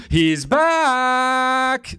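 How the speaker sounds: lightly voiced camp He's back, high and drawn out; a hint of a camp lilt on He's back.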